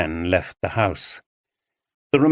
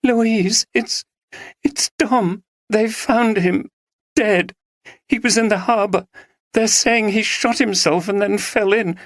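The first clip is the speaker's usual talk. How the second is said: crying on phone; 12 semitones higher than usual. Acted. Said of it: "she heard the sound of crying".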